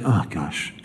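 low, soft, disapproving mutter; a quiet groan of disapproval; disapproving sigh. Retold or imitated. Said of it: "You see somebody".

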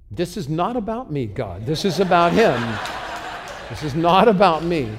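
loud, high, mock-outraged objection; indignant protest. Retold indignant protest to God.

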